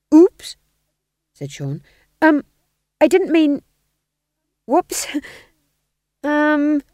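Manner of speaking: high, loud, halting apology; high-pitched stammering fluster. A narrator's reading. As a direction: blurt nervously and haltingly. Flustered apology while backing away.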